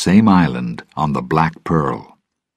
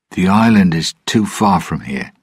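usual speaking voice

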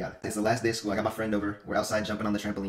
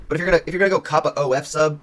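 ordinary delivery

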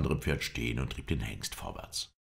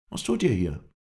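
high, louder questioning voice; raised character voice for the Kurd; Kurdish stranger; 6 semitones higher, 6 dB louder than usual. A narrator's reading. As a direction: throw the question back, pitched up.

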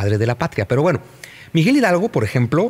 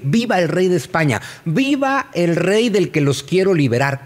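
mock rallying cry; 6 semitones higher than usual. Retelling Hidalgo's cry, mocking.

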